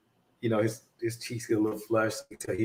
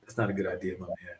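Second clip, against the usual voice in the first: low, much softer steady warning; calm him down under your breath; quiet warning; 4 semitones lower, 6 dB quieter, faster than usual. Retold or imitated. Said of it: "I was like".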